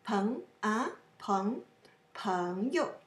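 soft 朋 rising, 友 short and neutral; neutral second syllable. Shown as a demonstration. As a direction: sweep up on 朋, keep 友 brief. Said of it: "The yo is neutral".

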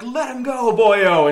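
high, loud Irish beat cop. Retold or imitated. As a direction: bellow it like an old Irish cop. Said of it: "he starts doing the Irish cop voice".

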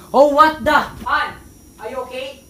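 alarmed. Acted. Partner finding honey unresponsive, alarmed. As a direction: react with a jolt of alarm.

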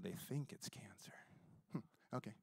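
very soft whispered echo. Retold or imitated. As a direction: whisper it back in calm disbelief. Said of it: "I said".

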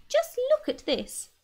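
excited call from downstairs; shrill excitement. Acted. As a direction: burst with delight. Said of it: "shrill with excitement".

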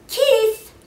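high loud call to the next room. A narrator's reading. Mother calling her son from the next room, loud. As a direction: call him from another room. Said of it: "his mother called from the next room".

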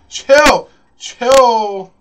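short, loud outburst; sudden yell. Real, not acted. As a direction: yell it out, drawing out the last one. Panicked reaction to threat in game.

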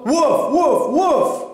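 three high imitated dog woofs; insistent barking. A narrator's reading. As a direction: bark repeatedly to make them follow. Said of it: "barked Ebb until Flo and Mom followed her".

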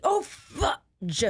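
high-pitched mock mom swearing, drawn out; high, exaggerated minced oath; mom's substitute cursing. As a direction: imitate a mom catching a curse and turning it into fudge. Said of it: "My mom said fudge instead of fuck".

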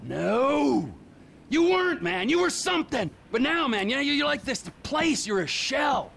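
high, agitated outburst; impassioned accusation. Acted. Accusing the old friend of becoming a shell, impassioned.

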